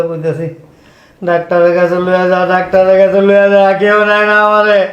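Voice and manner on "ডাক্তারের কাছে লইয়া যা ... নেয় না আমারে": very loud, higher-pitched plea; loud wailing complaint. Acted. Ailing father protesting nobody takes him to the doctor, loud wail. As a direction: wail it out at full volume, words run on.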